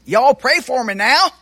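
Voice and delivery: a hint of a Southern kid calling out; Southern accent. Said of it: "in a charming Southern accent".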